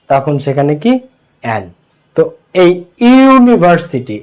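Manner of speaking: usual speaking voice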